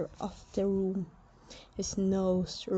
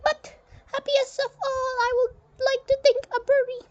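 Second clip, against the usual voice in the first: a much higher, firm general announcing the burial; stern general voice; 5 dB louder, faster than usual. Acted. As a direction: speak as a proud Egyptian general, high and set. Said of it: "His voice dropped to a murmur".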